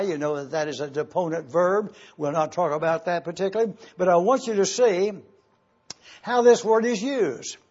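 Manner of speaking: ordinary delivery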